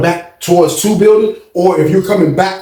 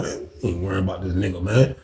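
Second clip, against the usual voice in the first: dismissive low mutter; 8 semitones lower, 10 dB quieter than usual. Retold or imitated. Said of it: "We like".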